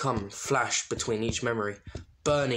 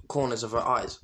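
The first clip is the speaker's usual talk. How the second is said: usual speaking voice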